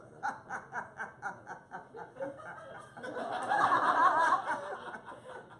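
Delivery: loud rolling laughter; long laugh. Real, not acted. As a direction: laugh hard.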